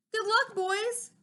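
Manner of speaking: high, slow, odd Lorax farewell; the Lorax crying goodbye to his creatures, high and slow; cried out. A narrator's reading. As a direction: call it out slow and high to the departing bears. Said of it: "he cried".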